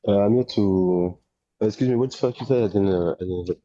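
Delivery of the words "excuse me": ordinary talk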